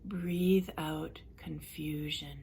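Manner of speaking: calm relaxation coach leading a breathing exercise; guided relaxation. Acted. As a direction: guide them gently through breathing, unhurried. Leads a guided relaxation session.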